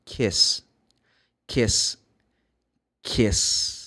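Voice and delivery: kiss repeated with a hissed s. Shown as a demonstration. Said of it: "It's almost like E".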